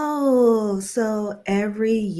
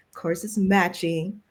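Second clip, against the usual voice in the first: ordinary delivery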